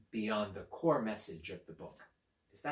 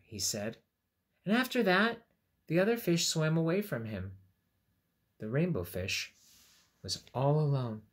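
higher, louder storytelling voice; storybook narration; 5 semitones higher, 6 dB louder than usual. A narrator's reading. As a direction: tell it brightly to little kids.

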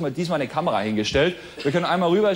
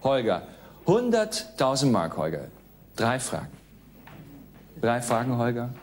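a hint of a strict teacher; lightly voiced quizmaster, softer; quiz show host; 4 semitones lower, 4 dB quieter than usual. Game show host quiz read.

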